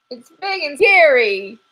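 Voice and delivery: high, loud squirrel voice, dragging out scary; a jittery squirrel spooked to the bone; scared squirrel. Acted. Squirrel telling the detective about the monster, high and loud.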